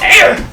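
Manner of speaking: high, loud startled squeal; goblin squeal. Acted. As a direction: yelp like a spooked goblin. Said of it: "It goes".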